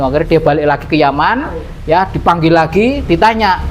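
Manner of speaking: normal talking voice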